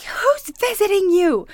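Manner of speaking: high, loud, drawn-out imitation of a yelling woman; mock screaming. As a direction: imitate an irate neighbor's screech. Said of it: "she's screaming at me".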